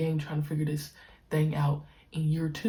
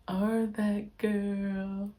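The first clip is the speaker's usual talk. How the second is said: very slow emphasis; 4 semitones higher, much slower than usual. Real, not acted. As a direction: let the words hang, one by one. Echoes the slow "that girl" claim.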